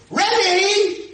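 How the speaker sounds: loud high-pitched call; girl calling out. Retold or imitated. Former student hails her rabbi from behind, calling out. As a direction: shout it to catch someone from behind.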